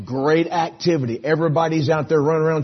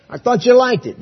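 surprised retort; 11 semitones higher, 9 dB louder than usual. Retold or imitated. Preacher's surprised reply to kid.

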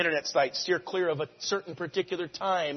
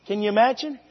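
ordinary talk, nothing marked

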